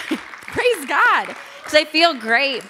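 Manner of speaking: high, loud, joyful declaration; exultant praise. Real, not acted. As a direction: belt out your relief and thanks. Celebrates her returned voice.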